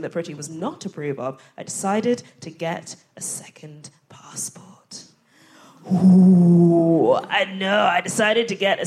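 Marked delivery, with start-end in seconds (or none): 1.6-4.7 hushed confiding whisper. Acted. Conspiratorial confession to audience. 5.9-7.1 loud drawn-out mock gasp.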